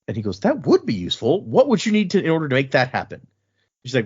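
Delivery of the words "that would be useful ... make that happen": higher, lightly acted interest; mildly intrigued. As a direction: retell a sudden, keen interest. Retold officer warming to the idea.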